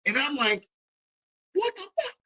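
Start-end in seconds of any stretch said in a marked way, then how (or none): 1.5-2.2 high-pitched stunned What the fuck; shocked outburst. Retold or imitated. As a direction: squeal it out in disbelief. Shocked seeing friends at store.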